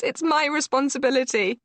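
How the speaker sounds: high, loud distressed wail. A narrator's reading. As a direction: insist through tears. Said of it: "she wails".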